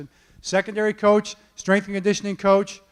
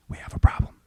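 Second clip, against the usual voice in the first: wife's scared whisper over the phone; retold whisper; 4 dB quieter, faster than usual. Wife whispering on the phone about a problem, hushed. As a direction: hush every word like someone might overhear. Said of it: "why are you whispering?".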